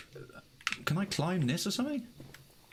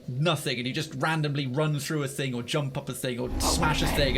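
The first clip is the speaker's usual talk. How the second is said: normal talking voice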